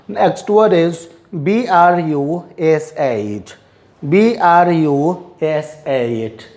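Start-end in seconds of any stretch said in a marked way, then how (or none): none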